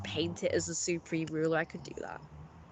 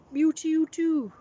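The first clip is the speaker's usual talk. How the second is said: high, louder singsong cat-like reply; playful mewing; 10 semitones higher, 6 dB louder than usual. Pretend. Streamer answers a mew in kind, high and playful.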